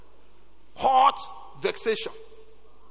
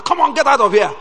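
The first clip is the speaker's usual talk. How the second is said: louder, higher command; threatening shout; 6 semitones higher, 10 dB louder than usual. Retold or imitated. Dean threatening a student to leave. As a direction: order him out.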